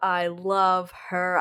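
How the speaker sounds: normal talking voice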